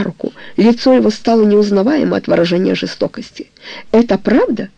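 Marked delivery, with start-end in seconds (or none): none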